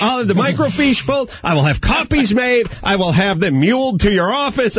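high, half-sung grand pronouncement; pompous sing-song. Pretend. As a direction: proclaim it like a pompous official. Mock grand proclamation.

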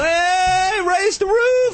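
very high, hooting party shout; imitating Oprah. Oprah mimicked greeting Will Smith, hooting. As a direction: whoop it up like a hyped host.

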